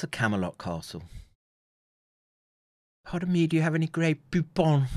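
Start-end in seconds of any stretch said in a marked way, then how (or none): 3.1-4.9 higher, put-on Grey Poupon gentleman. Streamer quoting the mustard ad in a higher put-on voice. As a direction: ham up the old mustard advert line.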